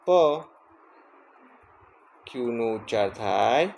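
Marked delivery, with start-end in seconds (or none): 0.1-0.1 પ as a lone "puh"; letter-sound demo. Shown as a demonstration. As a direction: sound out પ on its own. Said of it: "પીનો ઉચ્ચાર થાય". (પ નો અલગ ધ્વનિ ઉચ્ચાર)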